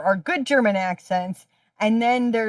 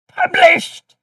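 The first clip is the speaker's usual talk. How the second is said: triumphant; 5 dB louder than usual. Acted. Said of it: "playful announcement".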